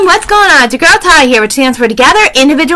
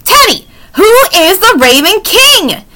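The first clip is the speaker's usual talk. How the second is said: mild, higher-pitched near shout; 6 semitones higher than usual. A narrator's reading. Jack demands an answer from Teddy about the Raven King, nearly shouting. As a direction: raise the pitch in exasperation, just short of shouting. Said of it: "Jack nearly shouted".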